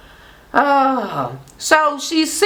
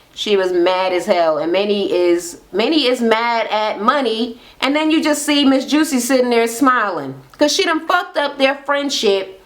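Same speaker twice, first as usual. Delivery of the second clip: ordinary talk, nothing marked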